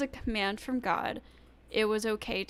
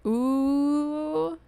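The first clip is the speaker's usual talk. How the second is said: drawn-out, raised singsong "ooh" tease; mock-scandalized, higher and teasing; playful taunt; 4 semitones higher, slower than usual. Host jokingly warns the video's makers they're in trouble, playful taunt. Teases, then says just kidding.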